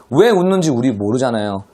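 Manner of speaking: ordinary talk, nothing marked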